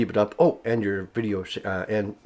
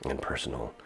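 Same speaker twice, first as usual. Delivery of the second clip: low, soft, mock-serious radio intimacy; close and personal; 6 semitones lower, 3 dB quieter than usual. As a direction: drop the voice and get confidential. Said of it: "get ourselves a little bit serious".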